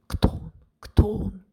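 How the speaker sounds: hushed whisper of guests. A narrator's reading. Guests at the ball whisper about the stranger. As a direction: whisper it among the crowd. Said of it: "перешептываются гости".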